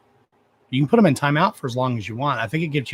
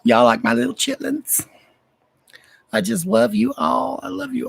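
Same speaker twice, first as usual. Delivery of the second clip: put-on much higher, squeaky baby-talk voice; squeaky cutesy voice; 10 semitones higher than usual. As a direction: coo at the fans like a tiny cartoon creature. Cutesy voice to fans.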